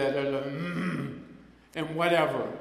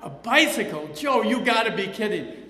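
louder, higher happy disbelief; delighted disbelief; 4 semitones higher, 6 dB louder than usual. Retold or imitated. Father thrilled by a bicycle gift, exclaiming. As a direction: act out dad's thrilled surprise. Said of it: "gave it to him for Father".

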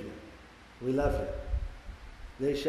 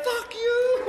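mock defiance; 6 dB louder than usual. Newcomer rejecting the advice.

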